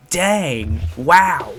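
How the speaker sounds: loud startled gasp of words; shocked exclamation. Real, not acted. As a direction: blurt out in shock. Reacting to gruesome death.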